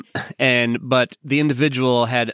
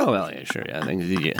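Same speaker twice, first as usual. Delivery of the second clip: awkward mumble; 6 semitones lower, 6 dB quieter than usual. Retold or imitated. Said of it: "I'm like".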